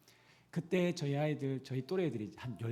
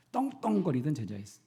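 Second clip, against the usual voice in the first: ordinary talk, nothing marked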